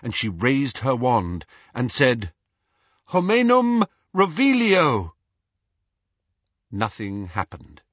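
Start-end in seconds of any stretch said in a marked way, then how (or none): 3.0-5.1 high, loud ringing incantation; spell incantation. Acted. Hermione casting a spell in the empty house, loud and ringing. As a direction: call the spell out with wand raised.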